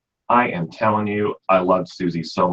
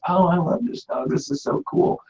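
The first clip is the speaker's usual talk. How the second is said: gushing new owner; 11 semitones higher, 4 dB quieter than usual. Retold or imitated. Owner gushing over new puppy.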